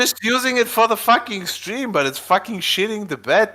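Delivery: high-pitched, frustrated protest; fed up, voice climbing; exasperated. Real, not acted. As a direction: defend yourself in real annoyance. Defends himself while browser fails.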